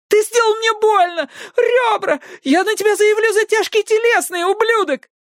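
high, tearful furious yell; screaming girl. A narrator's reading. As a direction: scream threats at him.

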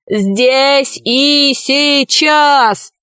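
high, very slow mock wail; chant-like drawn-out demand in a put-on voice; werewolves yelling. A narrator's reading. Werewolves refusing the vampire's warning, yelling slowly. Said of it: "вопили оборотни".

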